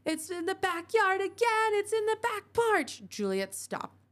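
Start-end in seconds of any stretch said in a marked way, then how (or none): 0.0-3.0 high, loud panicked scream; wife screams to Jim about the backyard gnome, terrified. Acted. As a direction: scream it in terror. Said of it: "she screamed".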